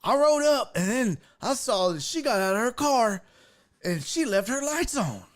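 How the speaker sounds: high-pitched, spaced-out storyteller impression; barely-there country guy. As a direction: imitate a simple man telling his tale. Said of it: "they're barely there. He was just like".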